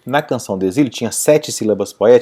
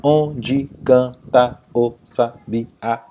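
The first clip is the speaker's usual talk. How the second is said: slow, separate beats on "Onde canta o sabiá"; syllable by syllable; 2 semitones lower, much slower than usual. Shown as a demonstration. Teacher counting grammatical syllables, "Onde canta o sabiá" syllable by syllable. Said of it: "Separando em sílabas gramaticais".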